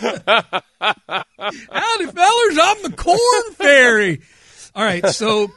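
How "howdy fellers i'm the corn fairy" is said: high, drawn-out Midwest farmer howdy; Midwest farmer accent. Acted. Corn fairy greeting the fellers, Midwest farmer accent. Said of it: "Your Midwest farmer accent is a good one".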